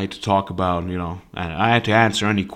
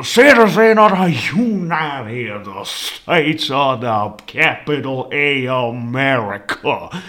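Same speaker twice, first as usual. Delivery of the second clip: slow, grandiose Southern drawl; Southern accent; slower than usual. Retold or imitated. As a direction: stretch it out like a Southern orator. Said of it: "started talking like a southerner".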